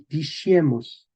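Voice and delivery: diciemos slowly as di-SHIE-mos; medieval pronunciation. Shown as a demonstration. Teacher reading an example, medieval sh pronunciation. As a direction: stretch diciemos with sh for the c. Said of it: "el sonido sh".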